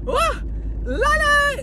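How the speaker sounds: very high happy sing-song squeal; gleeful squeal. Real, not acted. Vlogger overjoyed by her Diet Coke, high squeal. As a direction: squeal with pure joy. Excited over her drink.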